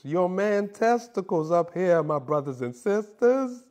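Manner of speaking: slow, drawn-out mock sermon; hammed-up sermon, words stretched; mock preaching. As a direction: preach it like a pulpit pastor, half-joking. Comic preaching run.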